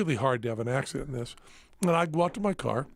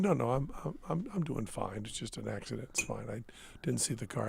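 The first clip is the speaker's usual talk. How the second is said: soft reassurance; 3 semitones lower, 8 dB quieter than usual. Retold or imitated. Reassuring wife after the crash.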